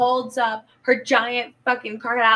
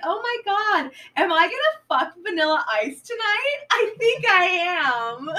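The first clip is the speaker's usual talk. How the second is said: giddy delight; 8 semitones higher, 4 dB louder than usual. Retold or imitated. Giddy about the celebrity date.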